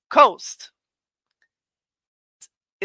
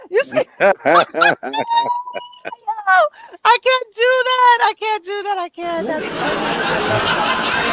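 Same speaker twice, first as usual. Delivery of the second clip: laughing; 11 semitones higher, 5 dB louder than usual. Real, not acted.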